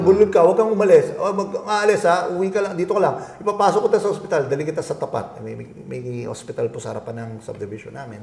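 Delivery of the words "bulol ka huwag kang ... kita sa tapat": loud and fast, insistent; urgent wife. Retold or imitated. Wife ordering him to stay for the hospital, loud and hurried. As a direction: rush the orders out, worried.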